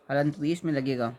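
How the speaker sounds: ordinary talk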